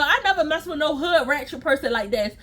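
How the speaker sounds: usual speaking voice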